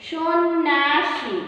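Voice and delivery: "সন্ন্যাসী" very slow, shon-naa-shi; silent glide. Shown as a demonstration. Teacher demonstrates the silent letter, very slowly sounded out. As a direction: draw out each syllable of "সন্ন্যাসী", no y-glide. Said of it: "অনুচ্চারিত হচ্ছে".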